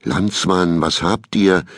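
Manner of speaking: plain reading voice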